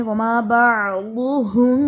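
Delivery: वमा बाअदुहुम very slow, the मीम held nasal before बा; ikhfa shafawi. Shown as a demonstration. Teacher correcting short ikhfa, slow nasal demo. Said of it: "मीम साकिन के बाद बा है".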